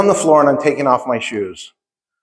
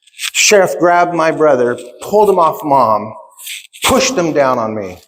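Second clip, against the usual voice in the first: ordinary delivery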